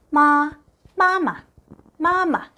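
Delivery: 마마 high and level, first syllable held up; Mandarin māma. Shown as a demonstration. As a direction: say 마마 as māma, high flat then light. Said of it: "엄마는". (마마를 높은 1성과 경성으로)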